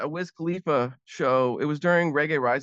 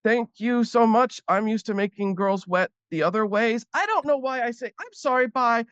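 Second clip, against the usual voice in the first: awkward thanks; 7 semitones higher than usual. Retold or imitated. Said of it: "I'm like".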